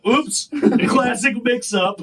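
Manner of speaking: drawn out with fake innocence, slowly; mock-innocent quip; sarcastic oops. Sarcastic retort to the instructor.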